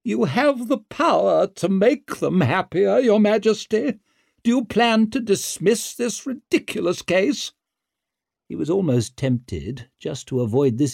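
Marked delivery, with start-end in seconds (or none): 0.0-7.5 high-pitched imperious mother; formidable woman barely holding her anger; Lady Wetterland, contained fury. Acted. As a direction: demand it in a raised, haughty voice. Lady Wetterland confronts the king.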